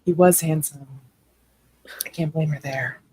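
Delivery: hushed whisper for dramatic effect; leaning in to whisper. Acted. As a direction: whisper it like a secret. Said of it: "I'm trying to whisper for dramatic effect".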